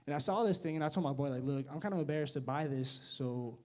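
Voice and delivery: ordinary delivery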